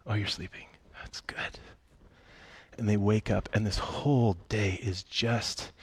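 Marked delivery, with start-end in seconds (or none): 0.1-1.6 very soft, fading to a whisper; hushed tenderness. Retold or imitated. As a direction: imitate checking on someone asleep without waking them. God softly checking on sleepers.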